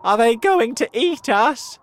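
high, loud panicky question; frightened earthworm. A narrator's reading. Earthworm asks if they will be eaten, frightened. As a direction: ask it as a scared little creature.

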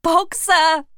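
high, loud call of a name; urgent shout. A narrator's reading. Clover calls to Boxer at the window, urgent shout. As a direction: call to make him hear.

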